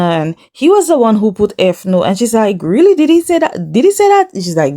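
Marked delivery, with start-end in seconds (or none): 2.6-4.3 high-pitched, mock-shocked questioning; incredulous reaction. Friend reacts to what he wrote, high and incredulous. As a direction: imitate a friend in disbelief, pitch climbing.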